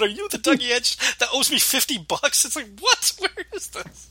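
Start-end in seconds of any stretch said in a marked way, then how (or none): 0.0-2.4 mock yell. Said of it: "be screaming at this guy over the telephone".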